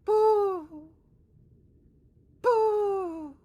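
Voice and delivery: high, far-off "Boo!" like a sonar ping. Retold or imitated. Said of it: "and he would go".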